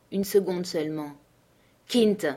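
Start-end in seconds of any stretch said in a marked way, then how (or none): none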